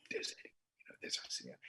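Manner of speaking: retold excited whisper. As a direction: whisper it like spotting stars in a theater. Audience spotting stars in theater.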